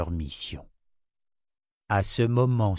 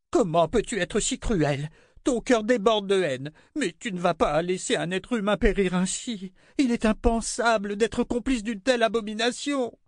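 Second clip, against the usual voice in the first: high, desperate queen's voice; pleading queen; 12 semitones higher than usual. A narrator's reading. Queen begging Rachel for help, desperate. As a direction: beg for help.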